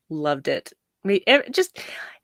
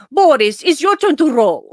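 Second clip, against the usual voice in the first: higher, louder Russian-accented Natasha impression; 7 semitones higher, 5 dB louder than usual. Natasha stopping the rowing to make Boris take over, higher and louder. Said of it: "she would just stop and say".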